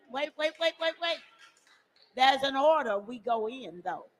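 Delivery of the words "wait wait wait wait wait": high, soft string of waits; quick repeated interruption; halting the crowd. Real, not acted. Stopping the chanting crowd.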